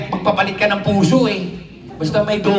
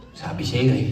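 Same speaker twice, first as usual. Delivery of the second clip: deep, smug "told you so" voice for the body; low mock body voice; 10 semitones lower than usual. Comic talking to himself in a low body voice. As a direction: speak as his own body, low and dry.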